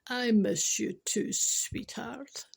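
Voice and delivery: slow tender mock. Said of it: "And the boss replied".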